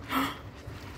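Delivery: sharp breathless gasp of surprise; a real gasp of shock; amazed gasp. Real, not acted. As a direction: gasp at the sight of the plants. Amazed at overgrown polytunnel.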